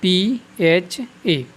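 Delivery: के एच ए spelled letter by letter, very slowly; के एच ए dictated letter by letter. Shown as a demonstration. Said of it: "इसका क्या होता है?".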